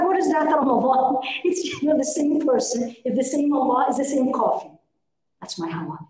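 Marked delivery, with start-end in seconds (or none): 0.0-3.5 laughing retort. Retold or imitated. Retelling her own retort, laughing.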